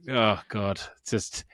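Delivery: usual speaking voice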